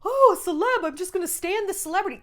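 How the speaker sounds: high gushing fan voice; mock fan. Mocking fans who excuse a celebrity, high gush. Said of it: "people are like".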